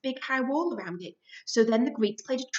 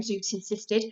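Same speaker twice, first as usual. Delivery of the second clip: usual speaking voice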